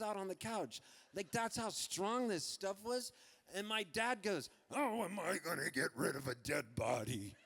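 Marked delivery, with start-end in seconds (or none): 4.7-7.4 put-on voice of a fuming father; angry dad. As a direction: grumble it like a cross dad. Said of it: "And he was so angry".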